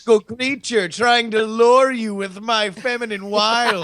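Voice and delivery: high, laughing seductive siren voice; siren impression. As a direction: lure them in as a mythical siren. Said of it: "it's my siren impression".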